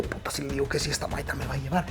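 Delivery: pensamiento susurrado. Retold or imitated. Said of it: "Y yo".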